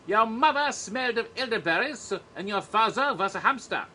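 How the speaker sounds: French knight taunting the English from the battlements; French taunter. Pretend. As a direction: hurl the insult from the castle wall. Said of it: "kniggets outside, taunting you".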